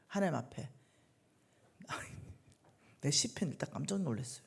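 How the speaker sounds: ordinary talk, nothing marked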